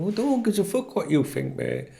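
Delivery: high, scornful brush-off; real irritated retort; angry retort. Real, not acted. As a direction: throw it back at him. Retorting to a critic.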